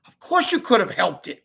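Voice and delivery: firm motherly scold; a touch of stern scolding. Acted. Mom scolding son for running away, firm. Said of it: "Mom scolded".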